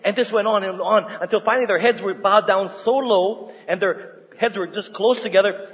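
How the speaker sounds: usual speaking voice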